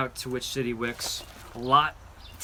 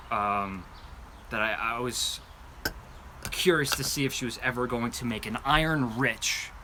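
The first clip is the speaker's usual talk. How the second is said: ordinary talk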